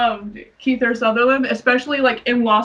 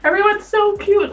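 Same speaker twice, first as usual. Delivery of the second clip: put-on starstruck child; 9 semitones higher than usual. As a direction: gush like a kid at the movie. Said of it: "I was like".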